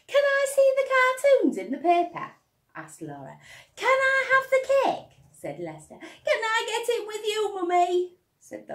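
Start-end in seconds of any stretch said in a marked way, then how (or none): 0.1-2.3 put-on much higher, louder little girl; a keen girl asking; eager girl. Laura asking. 3.8-5.2 put-on much higher, louder boy's voice; an eager little boy asking; eager child. Lester asking. 6.0-8.2 put-on very high, loud toddler offering; a proud little one giving a gift; beaming toddler. Toddler asking.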